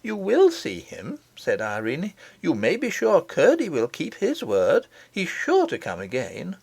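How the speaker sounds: a princess defending Curdie to her nurse; confident princess. Acted. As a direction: insist he will come back. Irene answers the nurse.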